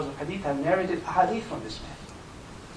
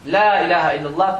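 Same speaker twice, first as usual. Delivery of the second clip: fast, louder Arabic recital; rapid recitation; 2 semitones higher, 6 dB louder, much faster than usual. Shown as a demonstration. Preacher teaches the dua, rapid recitation. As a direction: recite it for them to memorize. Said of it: "please memorize this".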